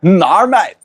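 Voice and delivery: high, loud mock-Australian drawn "nar"; Aussie accent. Streamer imitating an Aussie ex's way of saying no, Australian accent. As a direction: put on a teasing Australian twang. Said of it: "I dated an Aussie. Instead of "no".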